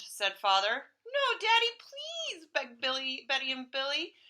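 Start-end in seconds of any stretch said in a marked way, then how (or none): 1.0-2.5 put-on high pleading child voice; child begging. Children begging father to keep dog, high pleading.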